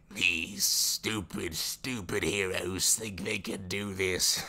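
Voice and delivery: slow, loud raspy grumble; raspy old father. Retold or imitated. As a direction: growl it like a bitter old villain. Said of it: "his old raspy voice".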